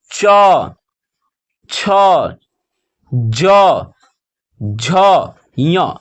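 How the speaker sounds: each letter alone and drawn out; teacher reciting the consonants, long pauses between; slow letter-by-letter drill; alphabet recitation. Shown as a demonstration. Reads out consonant chart.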